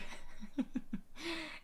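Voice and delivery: sudden high burst of laughter. Real, not acted. Streamer laughing at a funny chat message, high laugh.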